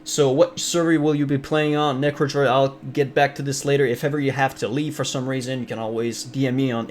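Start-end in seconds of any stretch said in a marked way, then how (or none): none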